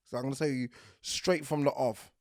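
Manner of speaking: usual speaking voice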